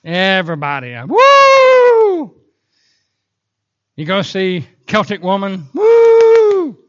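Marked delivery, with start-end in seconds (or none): 1.1-2.3 long, high, loud woo; sustained whoop from the crowd; audience cheer. Acted. Acts out excited audience. 5.7-6.8 long, high, loud woo; sustained ringing whoop; excited whoop. Acted. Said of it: "Everybody's excited".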